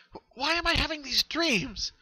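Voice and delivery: very high, stammering distress; anguished. Acted. Pinkie Pie asking herself why the dreams come, high and desperate. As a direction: plead with yourself to make it stop. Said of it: "Pinkie Pie shook her head viciously".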